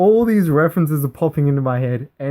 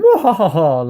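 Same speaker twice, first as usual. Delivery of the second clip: a hammed-up mock villain cackle, louder than the talk around it; evil villain laugh; 5 dB louder, slower than usual. Gloating at his opponent with a mock evil cackle. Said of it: "like the evil villain I am".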